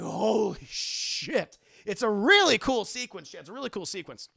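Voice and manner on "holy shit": slow, drawn-out stunned swear; mock awe. Recapper acts Batman seeing the robots smushed, slow mock awe. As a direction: stretch it out in mock amazement.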